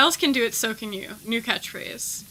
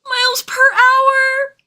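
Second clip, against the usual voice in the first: squeaky high, slow Miles per hour; high drawn-out punchline; 10 dB louder, slower than usual. Acted. Streamer answering the cat riddle, high and slow.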